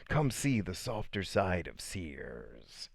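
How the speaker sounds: slow, soft ad voice; commercial voiceover imitation. Recalling a childhood TV commercial, slow soft ad read. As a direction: purr it like an old TV ad. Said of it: "Do you guys remember that commercial".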